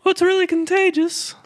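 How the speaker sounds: very high falsetto; silly guest-host character. Acted. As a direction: explain in a squeaky host voice. [name] in falsetto.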